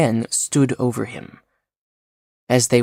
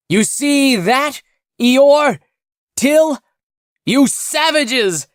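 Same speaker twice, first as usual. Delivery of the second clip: high, loud gloating yell; triumphant shout; 13 semitones higher, 5 dB louder than usual. Acted. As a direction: crow over your enemies at the top of your voice. Castaway gloats at rescue.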